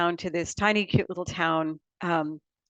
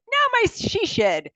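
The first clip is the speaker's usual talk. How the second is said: quoting herself; 13 semitones higher, 4 dB louder, slower than usual. Retold or imitated. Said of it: "which I said".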